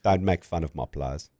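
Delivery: imitated South African warning, kept low-key; South African accent. South African cricketer scolding the announcer over his players, South African accent. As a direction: quietly tell someone off in a South African accent. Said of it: "his South African accent that you always im".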